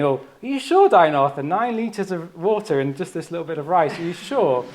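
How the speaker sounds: lightly mocking his careful doubt; Patrick's doubtful query. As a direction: mimic a concerned colleague double-checking, up high. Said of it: "he was, like, saying".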